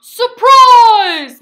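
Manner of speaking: big loud high surprise yell; booming surprise shout. A narrator's reading. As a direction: jump out with a shout. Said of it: "Mister Bear shouted".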